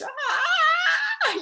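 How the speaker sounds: high, loud mock wail of a hurt child; imitating a kid's pained shriek; retold pained cry. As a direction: cry out like the hurting girl. Child in pain at wound.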